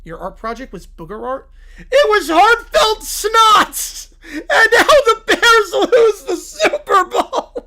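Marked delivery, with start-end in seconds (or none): 1.8-7.5 high, loud, slow mock sobbing; hammed-up wailing. Little Brown Bear wailing over his loss, high and loud. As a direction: wail it through fake tears.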